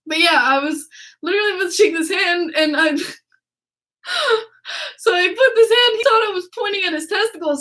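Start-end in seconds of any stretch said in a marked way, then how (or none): 2.6-7.6 laughing. Real, not acted. Laughing retelling.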